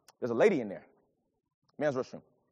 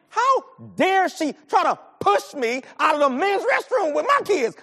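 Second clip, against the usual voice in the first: higher, louder, slow and heavy with outrage; heated indignation; 10 semitones higher, 5 dB louder than usual. Real, not acted. Preacher reliving outrage at woman in the restroom, heated. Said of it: "I was angry".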